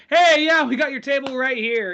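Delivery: high, loud, over-eager welcome; scared staff hailing Oswald, overly loud and bright; nervous eager greeting. Retold or imitated. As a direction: greet the killer loudly and nervously. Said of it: "Everyone's like".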